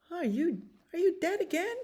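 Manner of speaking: high little skunk voice, rising at the end; questioning skunk. Acted. Skunk checks on possum playing dead, high questioning voice. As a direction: squeak the question upward. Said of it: "And the skunk said".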